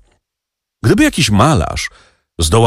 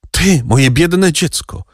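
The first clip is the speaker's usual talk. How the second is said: higher, slower, pained cry; a mother grieving for her child; anguished exclamation; 7 semitones higher, slower than usual. A narrator's reading. Mother crying out to her son in anguish. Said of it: "zawołała Mercedes".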